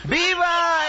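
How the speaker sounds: high launch of a patriotic cheer; crowd-rousing shout. Acted. Official starting the grito before blanking on the name, shouting. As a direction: shout it out to the whole square.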